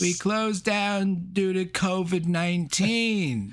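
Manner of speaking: high, slow hammed-up old man; deadpan the punchline as the old shopkeeper; mock old shopkeeper. Continuing the old-man bit.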